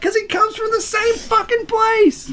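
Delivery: high-pitched protest. Acted. Arguing the accents share a source, high-pitched protest. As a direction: voice shooting up high. Heated defence of mixing accents.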